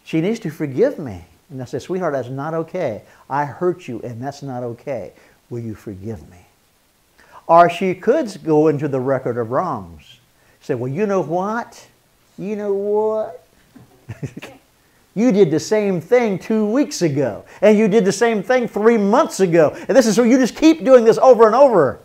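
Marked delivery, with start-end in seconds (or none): 1.8-6.4 quiet, tender apology to his wife. Retold or imitated. 10.8-22.0 a hint of an indignant wife; lightly voiced wife piling up old grievances; mock accusing wife. Wife voiced listing wrongs.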